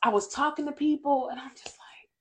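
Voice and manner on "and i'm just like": whisper. Retold or imitated. Retelling her irritated day.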